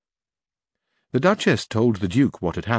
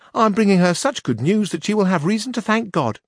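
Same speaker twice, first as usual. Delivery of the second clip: high, louder page voice; young page voice, raised and loud; 10 semitones higher, 3 dB louder than usual. A narrator's reading. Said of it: "said the page".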